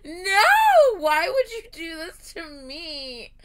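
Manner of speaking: loud, high, drawn-out wail; anguished protest. Real, not acted. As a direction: wail it in overblown dismay, stretching the words. Dismayed that everyone is crying.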